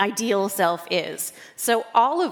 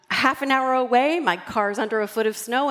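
ordinary talk

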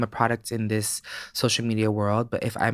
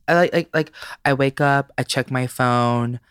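usual speaking voice

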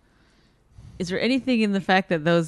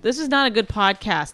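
ordinary delivery

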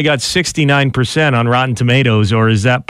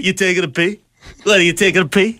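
De Niro impression; 5 semitones higher, faster than usual. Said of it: "Robert De Niro impression".

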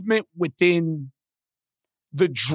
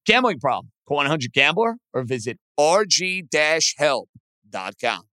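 ad read; 5 semitones lower than usual. Acted. Host reads sponsor ad.

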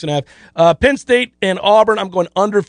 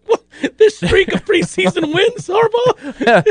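incredulous laughing; 11 semitones higher, slower than usual. Retold or imitated. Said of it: "I'm like".

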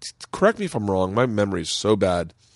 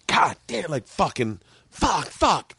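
mock frustrated cursing; 7 semitones higher, slower than usual. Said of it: "someone comes off and they bomb".

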